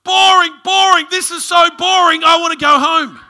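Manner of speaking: imitating a small boy loudly yelling his complaint; high, very loud mock kid shout; bored child's outburst. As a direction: holler it out like a fed-up little son in assembly. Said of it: "my son stands up and goes".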